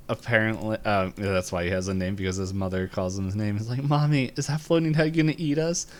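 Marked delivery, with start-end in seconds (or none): 3.8-5.9 lightly voiced child, pitched higher; little boy. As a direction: ask mother like a worried kid. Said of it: "a young boy and his mother".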